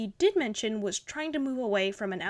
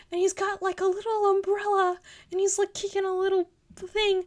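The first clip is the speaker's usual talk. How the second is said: high, louder cutesy gushing; cooing delight; 9 semitones higher, 4 dB louder than usual. Real, not acted. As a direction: coo like describing a puppy. Cooing over the pumpkin character.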